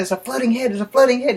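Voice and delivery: put-on high, urgent kid protest; insistent explaining. Boys trying to explain to dad, insistent and high. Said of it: "we kept trying to explain".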